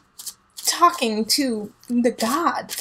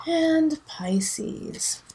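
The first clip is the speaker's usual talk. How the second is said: whispered announcement; 6 semitones higher, 3 dB quieter than usual. Acted. Reader introducing next sign.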